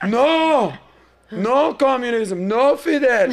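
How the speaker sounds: mock-outraged driver, high and loud; emphatic, drawn-out imitated protest; fired up. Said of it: "get 'em fired up on purpose".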